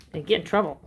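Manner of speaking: usual speaking voice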